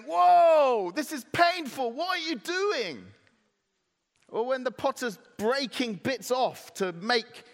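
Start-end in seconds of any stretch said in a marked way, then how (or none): 0.0-3.1 high, drawn-out yelp of mock alarm; hammed-up outraged howl easing off; pained protest. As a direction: cry out loud, then object in a high voice. Clay protests being smashed and beaten.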